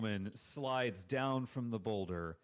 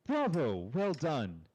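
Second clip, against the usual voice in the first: higher and louder, clapping with delight; excited bravo; 6 semitones higher, 7 dB louder, slower than usual. Acted. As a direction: cheer them on like a thrilled young bard. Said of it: "she's clapping excitedly".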